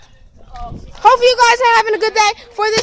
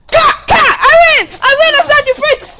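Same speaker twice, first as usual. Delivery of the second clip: raging gamer; 8 semitones higher, 9 dB louder than usual. Real, not acted. Arguing over who won.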